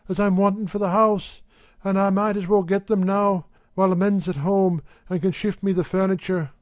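a hint of a brisk housewife; Mrs Radford's voice. A narrator's reading.

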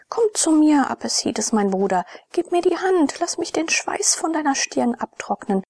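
lifted voice, earnest sisterly concern; concerned, pleading sister. A narrator's reading. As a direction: soothe and plead with a troubled brother. Narrator voices pleading Ione.

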